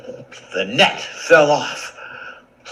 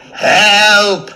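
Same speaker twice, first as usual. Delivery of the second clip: louder, higher desperate yells; cries for help; 13 semitones higher, 13 dB louder, much slower than usual. Acted. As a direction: shout for help, trapped.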